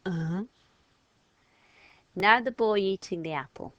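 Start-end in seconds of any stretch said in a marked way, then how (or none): 0.0-0.4 impolite uh-huh. Shown as a demonstration. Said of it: "put your hand to your ear and go".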